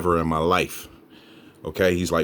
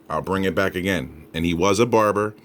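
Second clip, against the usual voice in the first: ordinary talk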